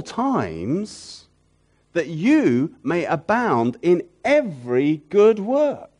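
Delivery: preacher declaiming Corinthians to the congregation, fervent; fervent scripture reading. Acted. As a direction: proclaim the promise slowly.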